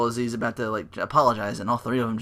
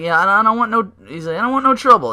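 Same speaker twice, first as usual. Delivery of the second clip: higher and louder, panicky pleading; hammed-up terrified plea; mock scared Cole; 9 semitones higher, 9 dB louder, faster than usual. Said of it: "He was like".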